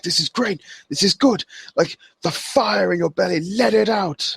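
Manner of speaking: imitating an elated wrestler; hyped-up wrestler quote. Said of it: "drops the Fandango accent".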